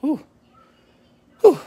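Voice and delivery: puffing out a winded breath; short exhale, catching breath; breathless whew. Real, not acted. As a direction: blow out air after a walk. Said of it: "I'm out of breath".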